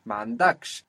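"Mandaksh" with DAKSH stressed; stress on last syllable. Shown as a demonstration. Teacher confirms the answer, stressing the end of Mandaksh. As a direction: put the accent on the closing "ksh" syllable of "Mandaksh". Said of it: "it will attract the accent".

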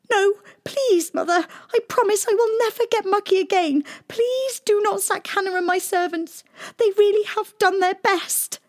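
high, fast, crying plea; a weeping girl begging her mother; tearful begging. A narrator's reading. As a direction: sob out a promise. Princess begs, in tears.

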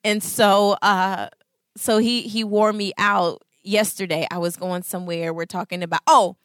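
normal talking voice

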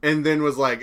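usual speaking voice

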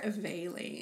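"valine" with a long a, as in veil; preferred pronunciation. Shown as a demonstration. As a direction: say "valine" as VAY-leen. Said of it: "I think I prefer".

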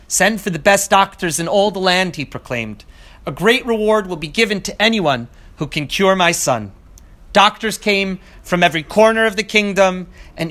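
measured storyteller reading, lifted and unhurried; story narration. A narrator's reading. Teacher reading a folk tale to students, storytelling voice.

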